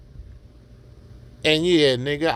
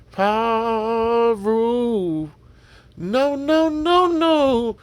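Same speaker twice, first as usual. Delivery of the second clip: high, louder mock call of paru and no, no; imitating Sinful; 9 semitones higher, 4 dB louder, much slower than usual. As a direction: throw your voice way up like the guy he is quoting, pleading no. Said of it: "like when Sinful used to say".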